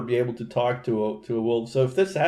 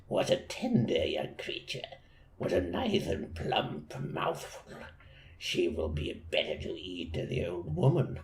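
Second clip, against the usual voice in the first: low, soft, breathy scheming wolf; a crafty wolf whispering his plan; sly wolf whisper; 5 semitones lower, 8 dB quieter than usual. Acted. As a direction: plot it to yourself in a low hush. Wolf's scheming thoughts read aloud.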